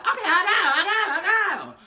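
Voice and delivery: high, loud, laughing yes; giddy agreement. Retold or imitated. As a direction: cave in with glee. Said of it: "So Issa was like".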